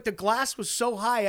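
ordinary talk

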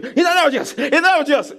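high, frantic cry for help; mock panicked praying. Preacher mimicking a believer who panics at demons, frantic mock prayer. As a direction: imitate someone yelling a panicked prayer. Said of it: "don't now start being".

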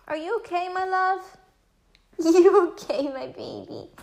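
high, doting coo. Real, not acted. Mother checking on her coughing son, real tender baby talk. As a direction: fuss lovingly over a little one.

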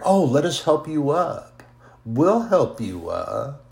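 lightly voiced, sing-songy fake helpfulness from devilish minions; a hint of mock sweetness, offering help. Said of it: "this little sing-songy thing they do".

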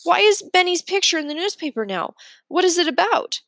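high and fast pressing; boy pressing his teacher about his friend, anxious; urgent questions. A narrator's reading. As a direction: demand an answer, worried. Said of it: "I look at Miss Blinny and say".

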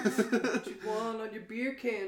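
put-on high, slow baby-talk voice. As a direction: coo it like talking to a baby. Teasing repetition to baby.

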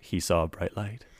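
loud, grand fairy-tale telling; storybook narration. Pretend. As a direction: open it like a bedtime story. Fairy-tale opening about himself.

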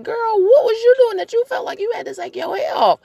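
put-on high, loud incredulous girl-talk; mock-scandalized interrogation; sassy questioning. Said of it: "my question is like".

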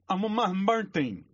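high, slow, mangled words; garbled-speech customer. Acted.

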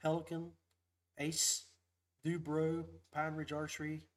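usual speaking voice

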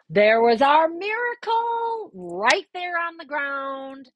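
high, loud, drawn-out proclamation; triumphant reveal. Real, not acted. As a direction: announce a miracle slowly. Climax, lost ticket found.

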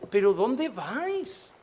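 exasperated Jesus. Retold or imitated. Jesus answering his disciples, slow and exasperated. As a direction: drag it out in weary disbelief.

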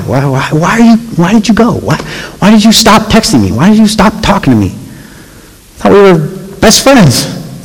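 high, pleading why to absent friends; hurt pleading. Pretend. Pastor asking absent friends why they left, hurt high pleading.

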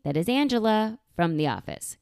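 normal talking voice

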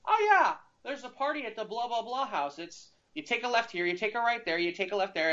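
high, cheerful officer giving directions; officer happily pointing the way to the party; friendly cop. Retold or imitated. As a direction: sing out the directions like a helpful cop. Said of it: "the officer goes".

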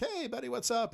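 high-pitched buddy hello; friendly greeting. Retold or imitated. Thief running into fellow thief Luke, friendly high greeting.

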